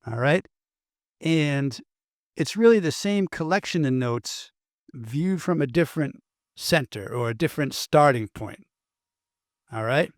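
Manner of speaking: ordinary talk, nothing marked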